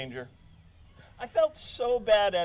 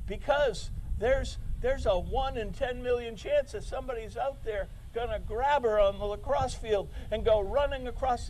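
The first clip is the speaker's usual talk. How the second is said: mock paranoid worry; 6 semitones higher than usual. Mocking an overprotective parent's fear.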